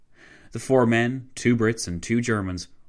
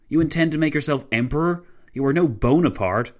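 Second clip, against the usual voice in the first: loud, raised-pitch indignation; barked challenge; 7 semitones higher, 5 dB louder than usual. A narrator's reading. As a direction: throw the accusation at him. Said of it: "Clavel barked".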